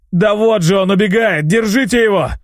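громкий высокий хриплый крик; кричи, указывая на беглеца; hoarse shout. A narrator's reading. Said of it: "прохрипел". (хриплый крик с пола)

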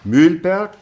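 loud, high call of the name. Acted. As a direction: summon the pupil sharply by name. Teacher calls a pupil. (laut und hoch aufgerufener Name)

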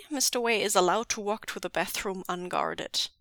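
ordinary delivery, nothing marked

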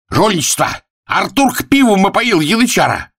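outraged accusation. Acted. Figure runs from the carousel accusing Arthur, high outcry. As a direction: cry foul in a high voice.

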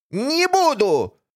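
high, slow snapping refusal; villainess. Acted. (резкий отказ злодейки)

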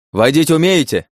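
loud, high, pressing question; urgent shout. A narrator's reading. Driver asks princess to take over mid-chase, shouting urgently.

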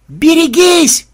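very high, slow, loud drawn-out warning cry; battle shout. Acted. Templar warning the girls in battle, long high yell.

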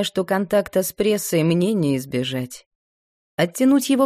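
ordinary delivery

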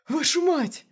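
high, loud furious curse; angry swearing. A narrator's reading. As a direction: spit out the curse in rage. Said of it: "ругался рослый мужик".

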